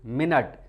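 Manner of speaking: मिनट said as "min-it" for time; मिनट, short i, stress on first syllable; say minute as minit. Shown as a demonstration. Teacher showing मिनट as the word for time. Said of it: "जब आप इसको मिनट पढ़ेंगे".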